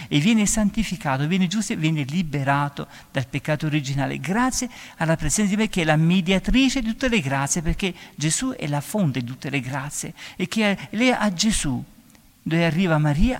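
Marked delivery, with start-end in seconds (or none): none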